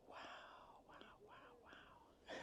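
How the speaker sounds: ordinary talk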